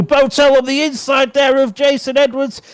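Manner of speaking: high-pitched, excited play-by-play; live race commentary. Real, not acted. Speedway commentator following riders on the inside, excited live commentary. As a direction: call the race as it unfolds.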